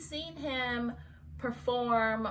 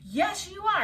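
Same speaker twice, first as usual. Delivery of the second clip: high, loud mock-angry retort; insistent officer; 10 semitones higher, 5 dB louder than usual. Cop contradicts her refusal to come outside, imitated forcefully. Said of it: "And he was like".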